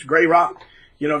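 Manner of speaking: usual speaking voice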